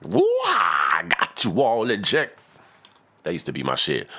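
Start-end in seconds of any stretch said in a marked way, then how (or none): 0.0-2.4 high, yelped rap opener; yelped hip-hop ad-lib, much higher. Retold or imitated. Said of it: "remember [name] used to".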